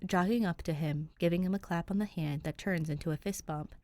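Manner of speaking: ordinary delivery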